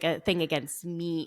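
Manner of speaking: ordinary delivery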